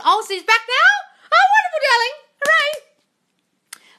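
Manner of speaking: very high, loud squealed cheer; theatrical delight. Acted. Coach celebrates a viewer's returning partner, theatrical delight.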